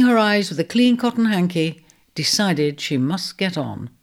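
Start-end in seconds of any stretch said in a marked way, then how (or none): none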